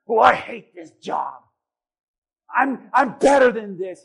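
loud, slow mock whine of a proud worker; whiny complaint.

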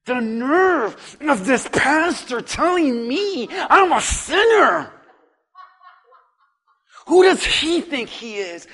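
offended, half-laughing scorn; indignant huff. Acted. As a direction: sneer at the pastor's nerve. Listener angry at the preacher.

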